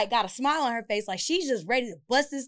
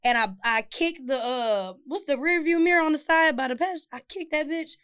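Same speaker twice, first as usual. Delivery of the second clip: ordinary talk, nothing marked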